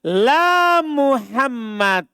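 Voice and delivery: drawn out, each word spaced; slow teacher demo of la Muhammad; slow example. Shown as a demonstration. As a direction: lay out the Arabic example slowly.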